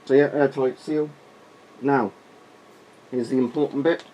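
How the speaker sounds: muffled behind a rubber mask; through a gas mask. Real, not acted. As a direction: talk through the mask's voice diaphragm. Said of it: "voice diaphragm hopefully looks quite good".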